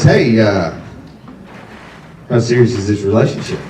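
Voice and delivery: a hint of a gruff dad. Dad's question quoted.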